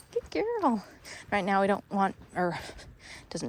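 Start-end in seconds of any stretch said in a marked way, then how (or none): 0.1-0.8 high praise voice. Real, not acted. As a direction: lift it up high for the dog. Praising dog during training.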